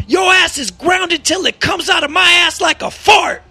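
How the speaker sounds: mock father shout. Father's absurd shouted punishment.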